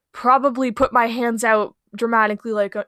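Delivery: ordinary talk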